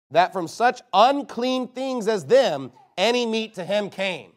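slow, emphatic declaimed verse; poem reading. Acted. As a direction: read it out line by line, slowly and forcefully. Reads out an old poem.

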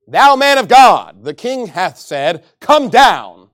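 loud, slow, sneering call of Thou man of God; mocking captain. Captain taunting the prophet, loud sneering call. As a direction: bellow it down the hill with contempt.

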